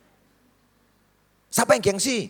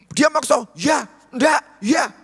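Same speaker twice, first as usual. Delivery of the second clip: mock argument; 10 semitones higher, 5 dB louder, much slower than usual. Retold tug-of-war with friend.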